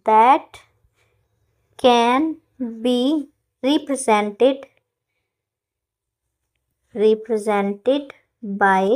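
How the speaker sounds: very slow, words spaced out; slow dictation. Shown as a demonstration. Teacher dictates definition to class, slow dictation.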